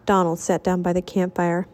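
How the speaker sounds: plain reading voice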